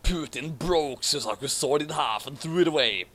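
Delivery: ordinary talk, nothing marked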